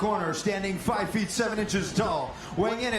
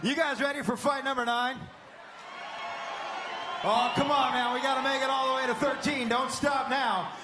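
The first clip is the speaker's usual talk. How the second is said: hype shout; 9 semitones higher than usual. Real, not acted. Hyping crowd between fights.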